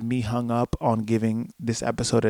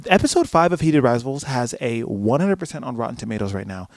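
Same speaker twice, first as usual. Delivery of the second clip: ordinary talk, nothing marked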